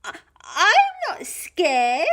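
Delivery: high, loud, stammered denial; Gruffalo's child, fearful bravado. Acted. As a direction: protest slowly in a little child's voice. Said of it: "said the Gruffalo's child".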